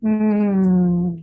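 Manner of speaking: long, satisfied "mmm"; savouring hum. Shown as a demonstration. Teacher settling on the pitch, long savouring hum. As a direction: hum as though savouring a treat. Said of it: "when you have something really delicious".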